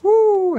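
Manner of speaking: high, loud "woo"; excited whoop. Real, not acted. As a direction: whoop over the haul. Pleased at the haul.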